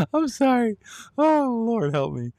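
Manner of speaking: can barely speak for laughing; helpless laughter. Real, not acted. Cracking up at the story.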